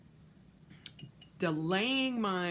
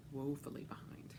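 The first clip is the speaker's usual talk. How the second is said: breathy whispered echo; whispering; 3 semitones lower, 12 dB quieter than usual. Shown as a demonstration. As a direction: whisper it to yourself. Repeats line as whisper.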